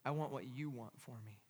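soft, low, accepting; surrendered prayer. Acted. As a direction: yield gently to the Lord. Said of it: "come to that place of surrender".

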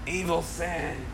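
slow, soft evil hissing; evil snake hiss. Shown as a demonstration. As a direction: hiss it like the serpent. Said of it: "You gotta say it like a snake".

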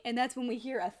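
normal talking voice

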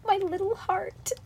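high, slow little pitiful voice; hammed-up wounded squeak; mock heartbroken. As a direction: play up the heartbreak in a tiny voice. Joins in the mock-pitiful act.

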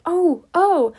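high, loud cry of delight; a sudden thrilled oh, oh; joyful cry. Acted. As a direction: burst out with joy. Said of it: "jumped up and cried".

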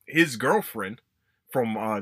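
normal talking voice

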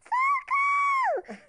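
high frightened shout; scream for help. Acted. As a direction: shriek it in panic. Dinosaurs cry for help.

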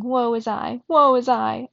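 moaning. A narrator's reading. Sweeper wailing behind the couch, moaning. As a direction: lament it with mild self-pity.

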